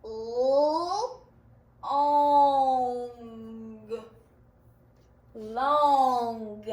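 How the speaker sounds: "Long" stretched slowly to hear each sound; "Long" sounded out slowly for spelling. Shown as a demonstration. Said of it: "let's sound that word out".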